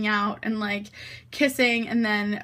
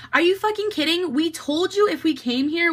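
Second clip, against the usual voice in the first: higher, incredulous retold protest; mock indignation; 6 semitones higher than usual. Catherine confronts the driver, mock indignant protest. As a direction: imitate a friend protesting, pitched up. Friend arguing over ride, acted.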